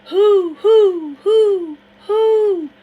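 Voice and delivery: very high, very loud hoots with laughter in them; laughing owl hoots. Acted. Harrison keeps hooting at the river, very loud, laughing owl calls. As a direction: hoot wildly, half laughing. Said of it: "Harrison kept on".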